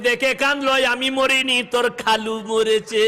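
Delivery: drawn-out singsong lament; hammed-up mourner's chant; mock wailing. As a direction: answer in a pretend keening wail, last word stretched. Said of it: "গিয়ে কাঁদছে".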